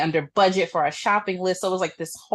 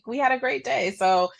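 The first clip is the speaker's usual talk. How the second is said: ordinary talk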